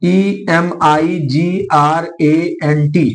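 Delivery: letter by letter, slow and clear; spelling slowly. Shown as a demonstration. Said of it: "spelling लिख लो".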